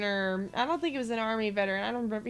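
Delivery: normal talking voice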